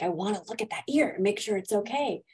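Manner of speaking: ordinary talk, nothing marked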